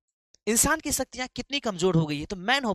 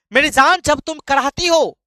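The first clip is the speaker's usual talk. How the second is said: loud, high dramatic appeal to a lover; impassioned lover; 8 semitones higher, 11 dB louder than usual. Acted. Poet calls out to his moaning beloved, impassioned.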